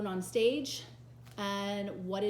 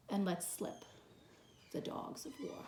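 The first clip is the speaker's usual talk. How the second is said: hushed menace; 7 dB quieter than usual. Acted. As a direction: drop to a whisper. Hushed after the battle cry.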